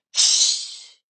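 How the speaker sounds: sharp unvoiced hiss; hissed shush. A narrator's reading. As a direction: hiss to silence someone. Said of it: "hissed Professor McGonagall".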